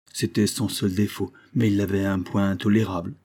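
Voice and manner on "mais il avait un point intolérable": ordinary delivery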